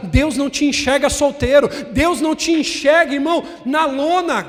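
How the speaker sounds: loud, high preaching build; preaching at full force. Acted. Pastor preaching loud to the church, full force. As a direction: preach it out to the congregation.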